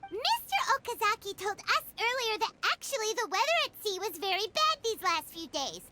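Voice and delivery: ordinary talk, nothing marked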